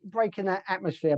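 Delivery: ordinary delivery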